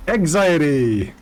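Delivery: high swoop falling away; singsong exclamation; mock cheer. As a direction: call it out playfully, pitch leaping up then dropping. Player repeats the comic exclamation.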